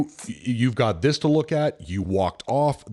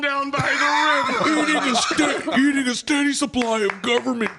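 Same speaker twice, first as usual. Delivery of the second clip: motivational speaker shout. Retold or imitated. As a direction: bellow it like a manic motivational speaker. Bit about van down by river.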